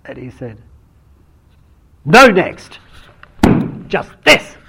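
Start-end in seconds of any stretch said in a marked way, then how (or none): 2.0-4.6 high, loud exuberant shout; Hogan's unrestrained outburst. Retold or imitated. Teacher bursts in on a friend's story, loud high exclamation. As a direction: burst in loudly, cutting the story off. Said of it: "Hogan just couldn't restrain himself".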